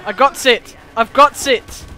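loud shout to a teammate; excited call-out. Real, not acted. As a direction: call it out urgently, loudly. Calling out during play.

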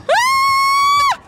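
high loud shriek; terrified scream. Real, not acted. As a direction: scream in fright. Scream while chased.

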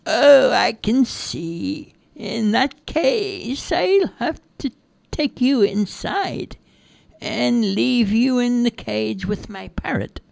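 very high, slow, drawn-out voice; high-pitched captor toying with a prisoner; Peggy, mock-sweet threat. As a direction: threaten in a high, sugary sing-song.

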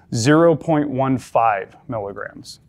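zero point one five slowly, zero clearly marked; stress on zero. Shown as a demonstration. Instructor giving the pediatric dose, stressing zero. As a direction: read the child dose slowly, landing on zero. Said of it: "But I'm emphasizing the zero".

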